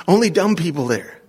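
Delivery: usual speaking voice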